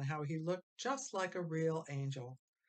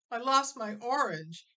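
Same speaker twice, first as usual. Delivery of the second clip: high, loud blurt; 7 semitones higher, 7 dB louder than usual. Acted. Frankie blurting his loss to Ma, loud. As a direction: burst it out all at once. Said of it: "Frankie blurted out".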